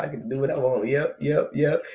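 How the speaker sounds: ordinary talk, nothing marked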